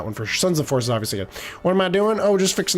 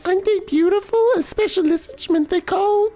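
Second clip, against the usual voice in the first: put-on much higher, girlish and sweet morning greeting; a cheerful young girl greeting her friend, pitched way up; Zelda voice; 14 semitones higher than usual. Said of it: "That's how I think they talk".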